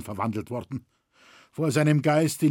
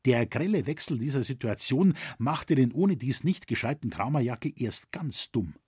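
plain reading voice

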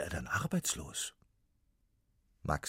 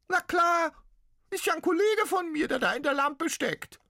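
very high, loud squeaky ghost cry; pitiful little ghost; 8 dB louder than usual. Acted. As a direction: wail it like a tiny trapped ghost. Ghost answers.

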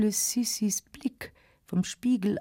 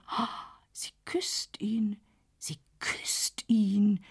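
slow shocked whisper; stunned whisper; 3 dB quieter, slower than usual. A narrator's reading. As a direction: breathe it out in disbelief. Else sieht den Kuss. (langsames, fassungsloses Flüstern)

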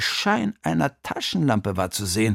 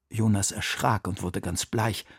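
plain reading voice

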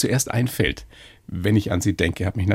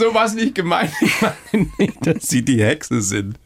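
laughing backpedal, pitched up then settling; 6 semitones higher, slower than usual. Real, not acted. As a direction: protest through real laughter, higher at first. Lacht über das Missverständnis zur Hexe.

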